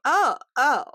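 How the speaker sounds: sudden loud oh of getting it; dawning realization. Retold or imitated. Acting out her sudden realization.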